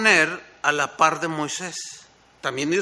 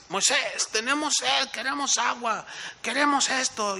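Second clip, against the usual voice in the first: higher, nagging plea of thirsty people; whiny complaining crowd; 8 semitones higher than usual. Retold or imitated. The people demanding water from Moses, whiny and high. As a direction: beg Moses like a whining crowd.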